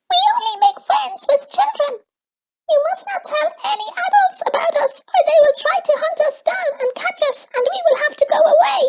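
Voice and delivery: very high, fast little creature; squeaky creature voice. A narrator's reading. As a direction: chirp it out like a tiny forest creature. Gleek voice held.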